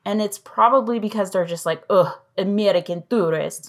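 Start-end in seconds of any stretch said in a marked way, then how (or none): 1.9-3.7 a clumsy, half-hearted mock French accent; bad French accent. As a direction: sneer it in a badly faked French accent. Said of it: "That was a horrible French accent".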